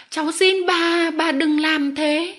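high, loud, drawn-out begging; desperate pleading. A narrator's reading. Remy begging the lady not to write, desperate plea. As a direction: beg her slowly and urgently to stop. Boy begs the lady once more.

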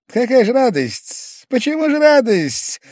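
high-pitched, loud indignant retort; a wounded little man yelping back; indignant high protest. A narrator's reading. Pavel Pavlovich snapping back at his accuser, high and loud.

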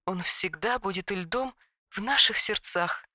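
quiet, solemn and mournful; solemn sorrow. A narrator's reading. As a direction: say it like a eulogy. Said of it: "произнесла с торжественной грустью".

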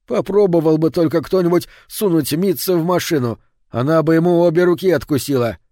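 worked-up indignation; indignant outburst. Acted. Husband rants about crimes.

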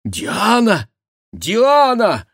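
high, loud outcry of a name; shouting. A narrator's reading. Baron cuts off his daughter, shouting. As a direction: shout it in shocked reproach. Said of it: "закричал барон".

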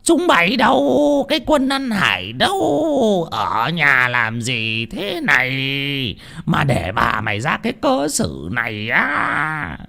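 slow, high furious bellow at the servants; the chief enraged at his wife's state; drawn-out angry demand; raging chief. Acted. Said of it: "long sòng sọc lên".

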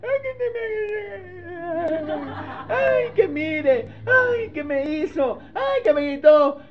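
high, very slow wailing whine, half-sobbing; mock crying complaint. Preacher mimics a married couple complaining after ignoring advice, high wailing whine. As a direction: whimper out each ay like a wronged spouse. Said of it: "Y luego vienen".

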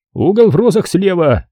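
high-pitched repeat of garbled officialese; police phone voice. A narrator's reading. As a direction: repeat the department name high, by rote.

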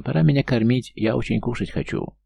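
high squeaky little gnome. Acted. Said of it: "пропищал гном". (писклявый голосок гнома)

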